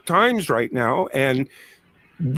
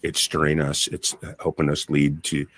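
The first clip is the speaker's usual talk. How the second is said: lower, hushed card reading voice; 10 semitones lower than usual. Acted. Tarot reader laying out cards.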